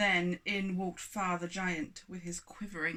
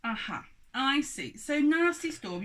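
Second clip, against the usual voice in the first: accusing giant; 7 semitones higher, 6 dB louder than usual. Acted.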